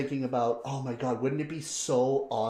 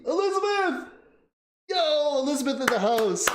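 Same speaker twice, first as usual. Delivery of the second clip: much higher, shouted-out welcome; excited greeting; 12 semitones higher than usual. Real, not acted. Streamer welcomes Elizabeth to chat, high and excited. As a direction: hype up the viewer's arrival.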